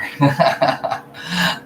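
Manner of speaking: breathy burst of laughter; amused laugh. Real, not acted. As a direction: laugh at the card name. Laughs at card name.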